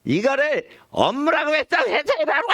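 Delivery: high, scolding boss voice; department head. Acted. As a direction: play a boss chewing out an employee. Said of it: "부장님이". (부하를 나무라는 부장 목소리)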